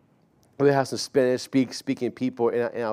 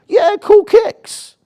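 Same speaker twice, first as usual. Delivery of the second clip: put-on much higher, louder and slow teen voice; imitating a teen; 12 dB louder, slower than usual. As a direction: stretch it out as the eager kid, high and loud. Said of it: "They'd be like".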